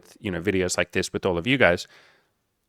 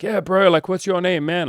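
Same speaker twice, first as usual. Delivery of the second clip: friendly bro voice; 9 semitones higher, 3 dB louder than usual. Retold or imitated. Drunk guys welcoming newcomer.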